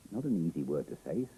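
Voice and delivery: usual speaking voice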